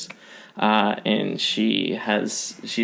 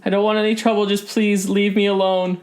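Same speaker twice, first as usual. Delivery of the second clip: higher, pleading woman in hiding; hiding half-elf pleading with the cleric, higher; frightened shopkeeper; 9 semitones higher than usual. Acted. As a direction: beg them to go away.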